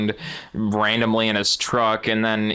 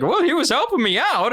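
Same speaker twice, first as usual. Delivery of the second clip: a much higher mock grandpa voice; 13 semitones higher than usual. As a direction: squeak it out like a sheepish grandpa. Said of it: "He's like".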